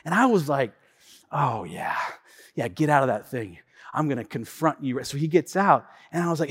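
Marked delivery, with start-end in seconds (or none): 1.3-5.0 low, soft threat to himself; hushed, low-pitched mock showdown; mock menace. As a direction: size him up under your breath. Muttered threat before confronting valet.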